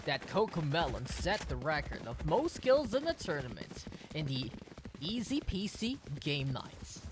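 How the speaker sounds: low, hushed record-official voice; world record announcer impression. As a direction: push the voice down as low as it goes. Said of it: "that's my impression of the, the world record".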